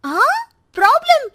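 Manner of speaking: high startled question; sharply surprised, pitch jumping up. Acted. Sister jolts at news of a problem.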